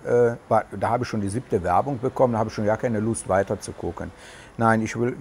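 ordinary talk, nothing marked

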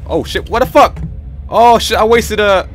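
sudden high startled yelp. Real, not acted. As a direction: jump at the scare. Jump scare in horror game.

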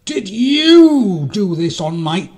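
high, loud, slow demand; accusing mole. A narrator's reading. Little mole going to ask again, loud accusation. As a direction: start a stretched-out indignant question.

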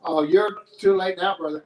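loud wrestler taunt; mock wrestling threat; Hulk Hogan. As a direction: boom it like a pro wrestler. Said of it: "I look like Hulk Hogan".

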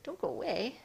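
playful high cat plea; voicing the cat. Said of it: "she's like".

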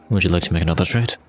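low, composed dealer's offer; in-character voice. Acted. Character holding soul offers another trade, low voice. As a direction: make the offer low and smooth. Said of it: "and I'm like".

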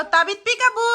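high, loud sing-song peekaboo; mother playing peekaboo with her baby, high and loud; playful peekaboo. Shown as a demonstration. Prompting daughter to call baby.

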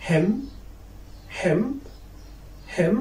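"hem" ending on m, no n sound; teacher's careful "hem", final N left silent. Shown as a demonstration. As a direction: model "hem" as a short word closed on m. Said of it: "you cannot pronounce it after M".

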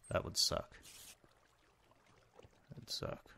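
whispered aside. Real, not acted. Worried about cave spiders, a whispered aside. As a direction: breathe it out under the breath. Muttering fear of spawner.

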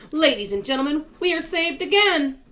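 high, loud triumphant proclamation; jubilant announcement. Acted. Old grasshopper tells everyone they are saved, jubilant announcement. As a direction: announce the rescue with rising excitement.

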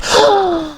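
very high startled gasp; real frightened gasp; falling gasp. Real, not acted. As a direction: gasp while dropping. Falling from height.

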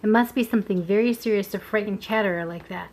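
ordinary delivery

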